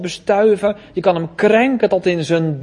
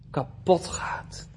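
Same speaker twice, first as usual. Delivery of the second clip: slow, hushed whisper, much softer; 3 semitones lower, 8 dB quieter, slower than usual. Acted. Preacher to congregation, slow whispered warning. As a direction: breathe it out like a grim secret.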